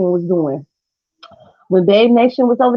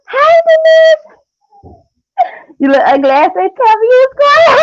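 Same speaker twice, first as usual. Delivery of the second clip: mock comment voice; 12 semitones higher, 3 dB louder than usual. Reading a roast comment aloud.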